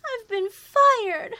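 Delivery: sobbing. Acted. Secretary tells roommate she was fired, crying. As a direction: wail the news through tears.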